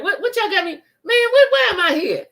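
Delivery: high, loud, bewildered mock voice; mocking confused man. Mocking the guest's confused on-air reaction, high and loud. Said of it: "They looking all around like".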